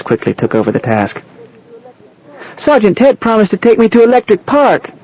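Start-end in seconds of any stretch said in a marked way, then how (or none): 2.6-4.9 put-on high-pitched eager child voice; high little-kid voice; small boy. A narrator's reading. Small boy wakes and speaks up.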